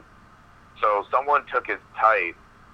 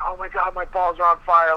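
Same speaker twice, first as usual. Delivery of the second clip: higher, whiny mock wail of pain; screaming like little kid; 8 semitones higher than usual. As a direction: play a kid crying out in burning pain. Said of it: "screaming like a little kid".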